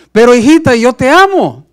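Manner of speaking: high, loud, anguished declaration; anguished cry. Acted. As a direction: cry out your love in despair. Padre implora a la hija. (grito angustiado, alto y fuerte)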